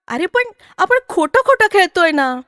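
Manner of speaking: high-pitched, aggrieved objection; protesting child. Acted. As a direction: plead that it is unfair, like a kid.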